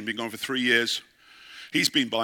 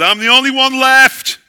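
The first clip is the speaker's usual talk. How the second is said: much higher, louder anguished wail "I'm the only one left."; desperate cry; 12 semitones higher, 13 dB louder than usual. Acted. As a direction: cry it out like a broken prophet. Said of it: "having a major emotional breakdown".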